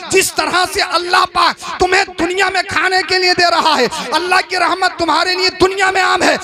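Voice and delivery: sermon building to a loud, high shouted climax; fervent shouted preaching, easing only at the last words; full-force preaching. Real, not acted. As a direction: thunder it out to rouse the congregation.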